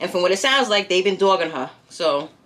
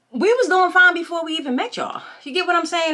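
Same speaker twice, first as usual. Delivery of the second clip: indignant outburst; 8 semitones higher than usual. Real, not acted. Vlogger tells viewers off for the audacity, pitched-up indignant outburst. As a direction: push back hard at the audacity.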